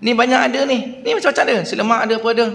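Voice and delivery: high, indignant stall aunty scolding. Retold or imitated. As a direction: tell him off as ungrateful, pitched high. Aunty scolding ungrateful buyer. (mak cik menegur pembeli tidak bersyukur, suara tinggi)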